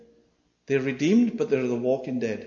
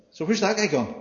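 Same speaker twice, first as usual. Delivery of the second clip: ordinary talk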